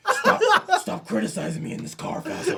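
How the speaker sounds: high, loud laughing yell; laughing shout. Pretend. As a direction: yell it while cracking up. Acted speaker, laughing.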